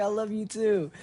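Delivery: high-pitched friendly call back; called-back goodbye. Retold or imitated. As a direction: imitate a friend returning the farewell.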